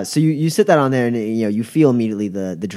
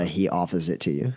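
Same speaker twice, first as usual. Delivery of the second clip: usual speaking voice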